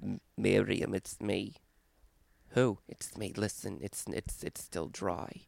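creepy hushed prank call; hushed caller. Retold or imitated.

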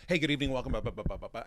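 loud, rapid-fire auctioneer greeting; fast auctioneer patter. Retold or imitated. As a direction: rattle it off like an auctioneer. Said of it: "I was speaking very fast like an auctioneer".

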